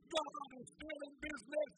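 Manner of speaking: loud, high preaching at full force; fiery preaching. Acted. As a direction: preach it out to the congregation.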